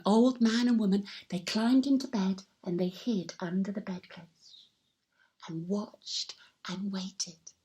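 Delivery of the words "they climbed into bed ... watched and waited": hushed. Acted. Telling children how the couple hid and waited, hushed. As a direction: draw it out in a hiding whisper. Hiding, waiting quietly.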